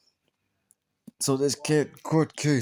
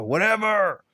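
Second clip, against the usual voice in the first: higher, exasperated dismissal; 5 semitones higher, slower than usual. Real, not acted. Reviewer fed up with the film's nonsense, exasperated. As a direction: throw it away in exasperation.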